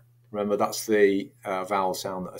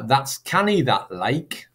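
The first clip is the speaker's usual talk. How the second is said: lilting Geordie, high at the start; Geordie accent; 4 semitones higher, slower than usual. Shown as a demonstration. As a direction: say it like someone from Newcastle. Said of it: "possibly from the northeast".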